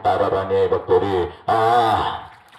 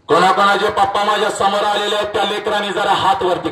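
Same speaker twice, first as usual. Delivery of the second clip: higher, louder call to the crowd; raised voice summoning the fathers; 10 semitones higher, 4 dB louder than usual. Real, not acted. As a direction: call it out across the hall. Calling out to the crowd.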